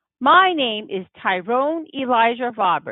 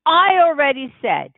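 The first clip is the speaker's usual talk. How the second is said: Daddy snapping back; 7 semitones higher, 5 dB louder than usual. Acted. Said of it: "Daddy starts up".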